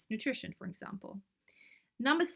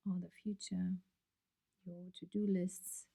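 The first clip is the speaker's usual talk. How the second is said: guided meditation; 3 dB quieter than usual. Acted. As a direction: ease them gently into breathing and stillness, trailing into near-whispers.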